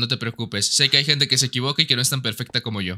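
low, self-important mock grandeur; mock-lofty pardon. Gamer grandly pardoning an apologetic teammate, low mock-lofty tone.

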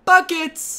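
high, loud imitation of the friend's cheer; mock hype cheer. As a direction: hype it up like the friend behind the camera. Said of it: "What a nice friend".

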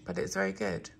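chewing while talking; mouth full. Real, not acted. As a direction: talk through a mouthful. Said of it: "sorry if you can hear me chewing".

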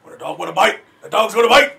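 raspy, menacing shouted rap. Acted. Demonstrating rap voice types, raspy tough-guy shout. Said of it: "the graspy voice one where they're trying to be too hard".

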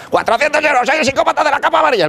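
psycho robber voice. Acted. Robber repeats demand.

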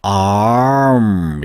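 "arm" stretched long, ah gliding into R; drawn-out R. Shown as a demonstration. Teacher shows the tongue lifting, very slow "arm". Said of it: "혀가 들리는 거".

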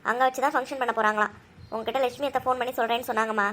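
ordinary talk, nothing marked

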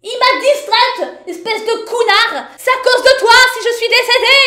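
high, loud, indignant rant; angry outburst. Real, not acted. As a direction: shout the blame at whoever distracted you. Blames character after game death.

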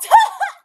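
short, high, explosive laugh; sudden real cackle; loud laugh. Real, not acted. Laughs at burying people.

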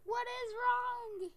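loud, high, stretched-out question; exasperated outcry. Real, not acted. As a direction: wail it slowly at the game. Exasperated outburst at the game.

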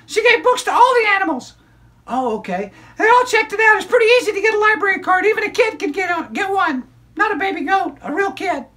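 put-on high, bright child puppet storyteller; squeaky kid character excitedly telling a story; high-pitched puppet girl narrating.

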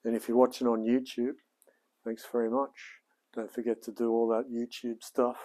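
upbeat presenter read to camera; a rehearsed intro spoken straight to the viewers; channel intro take. Shown as a demonstration. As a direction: deliver the show intro like a host. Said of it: "That was all right. That was a good one".

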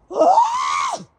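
high loud cry; sudden shriek. Real, not acted. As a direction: let out a sharp high yell. Sudden scream.

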